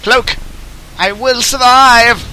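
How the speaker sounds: slow, high heroic cry; mock-heroic defiance. Gamer under fire after cloaking, mock-heroic cry. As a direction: declare it grandly, stretching the words.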